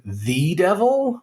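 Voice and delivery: high, slow, disbelieving question; a priest who can't believe his ears, drawn out high; incredulous priest. Retold or imitated. Priest answering the possessed girl, slow high incredulous echo. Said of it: "replies with".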